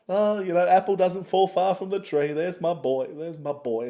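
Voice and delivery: loud, high hammed-up mum voice; proud mother. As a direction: gush over your boy, hammed up. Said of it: "she's like".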